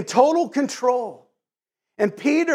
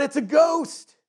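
mild fearful cry; 6 semitones higher, slower than usual. A narrator's reading. Terrified disciples on seeing a figure on the water, mild fearful cry. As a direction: read it as a contained, frightened exclamation. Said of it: "In their fear, they cried out".

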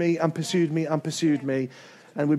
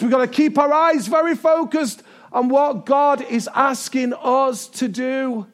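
rising, shouted, higher exhortation, then slowing hard; preaching shout; 7 semitones higher, 4 dB louder than usual. Real, not acted. Pastor urges church to stay focused, shouting higher. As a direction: drive it home to the church.

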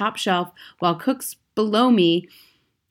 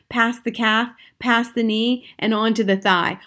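ordinary delivery, nothing marked

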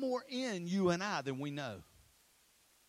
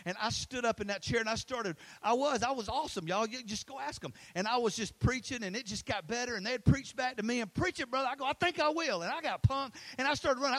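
high, loud, excited storytelling; pumped-up preaching; 6 semitones higher, 5 dB louder than usual. Real, not acted. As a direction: build up like a preacher getting carried away. Relives getting pumped while preaching.

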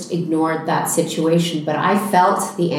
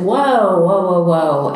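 calling whoa; 5 dB louder, slower than usual. Retold or imitated. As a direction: cut in firmly to stop a rising argument. Stepping in to stop angry man.

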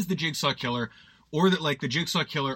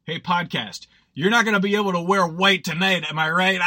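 louder, leering party-guy jab; best man ribbing the bride at the wedding, louder and leering; drunk douchebag best man; 3 semitones higher, 6 dB louder than usual. Pretend. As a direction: nudge the bride with a crude joke. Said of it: "the classic drunk best man douchebag thing to say".

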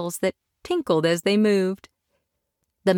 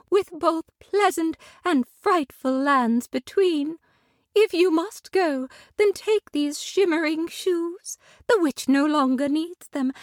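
tender lady; 9 semitones higher than usual. Acted. Glinda tenderly welcoming Dorothy to Munchkin Land. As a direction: welcome her gently and graciously.

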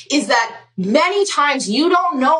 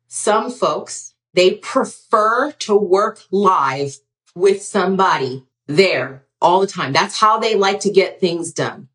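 lower, deliberately even, no inflection; without inflection; 7 semitones lower than usual. Shown as a demonstration. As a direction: say it carefully neutral, holding back the petty. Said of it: "I'm gonna try to say this without any inflection".

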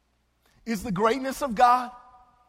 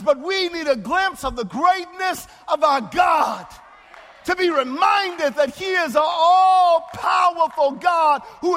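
swelling sermon proclamation; preaching; 6 semitones higher, 5 dB louder than usual. Acted. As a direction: preach it out with full force. Preacher at full force.

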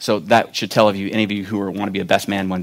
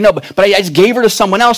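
higher, louder and fast flustered excuse; flustered father explaining the bride is already given away; 9 semitones higher, 7 dB louder, faster than usual. Retold or imitated. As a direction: stammer out the excuse in a rush.

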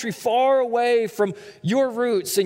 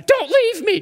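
put-on high, loud little-kid plea; Beckett, three-year-old son; 13 semitones higher, 5 dB louder than usual. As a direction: squeal it like a pleading toddler. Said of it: "in my three-year-old son's voice, Beckett".

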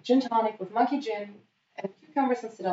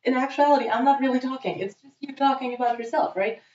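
raised, higher voice in retold argument; arguing; 6 semitones higher than usual. Imagined retort to the date during a fight, higher indignant protest. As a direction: push back at a self-absorbed date. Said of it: "we basically started a fight".

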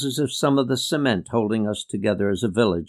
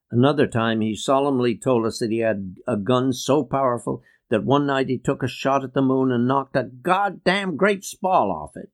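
usual speaking voice

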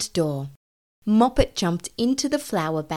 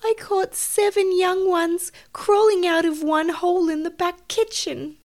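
high, upset mother cat voice; tearful; 11 semitones higher than usual. Acted. Tabitha telling of young rats in the kitchen, tearful. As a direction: recount it tearfully, pitched high. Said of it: "said Tabitha tearfully".

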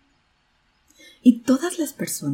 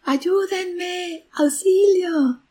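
high, drawn-out call for help; child crying for help; 12 semitones higher, 4 dB louder, much slower than usual. A narrator's reading. Trapped boy calls out for help on the mountain, high drawn-out cry. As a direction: call out high with long held vowels. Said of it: "el niño comenzó a gritar".

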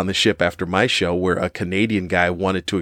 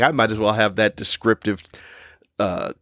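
usual speaking voice

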